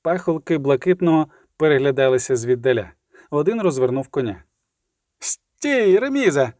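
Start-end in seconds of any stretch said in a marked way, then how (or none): 5.2-6.5 high-pitched call to halt; shouted command. A narrator's reading. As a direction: call out sharply to stop a rider. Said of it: "крикнув Скиглик".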